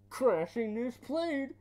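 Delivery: slow high loud villain. Retold or imitated. Bane scene dialogue.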